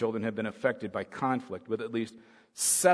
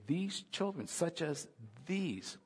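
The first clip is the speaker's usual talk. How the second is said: softer, gentle words; soft Jesus voice; 4 semitones higher, 9 dB quieter, slower than usual. Retold or imitated. Preacher voicing Jesus about the children, softly. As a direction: say it gently, almost hushed. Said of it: "Jesus began to say".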